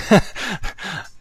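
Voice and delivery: short high-pitched laugh. Real, not acted. Payments CEO amused at calling blockchains terrible, short high laugh. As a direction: break into a real laugh. Laughs at his own blunt verdict.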